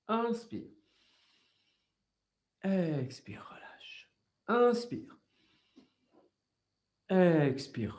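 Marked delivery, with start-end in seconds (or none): 2.6-4.1 voiceless whisper, breath only; whispered exhale cue. Shown as a demonstration. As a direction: breathe the words out. (souffler la consigne en chuchotant)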